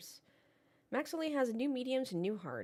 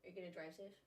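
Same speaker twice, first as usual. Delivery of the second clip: hushed phone call; 16 dB quieter, faster than usual. Real, not acted. Quiet phone call goodbye.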